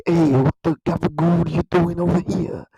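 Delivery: caricatured New York wise guy; New York gangster. Acted. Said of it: "classic New York gangsters who are like".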